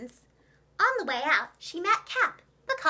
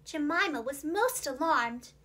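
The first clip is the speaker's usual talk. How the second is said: usual speaking voice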